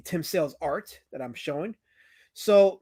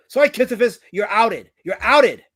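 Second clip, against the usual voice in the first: loud, high mocking reveal; out him with a shout; gleeful loud outing; teasing call-out; 5 semitones higher, 11 dB louder than usual. Real, not acted. Teasing a chat member.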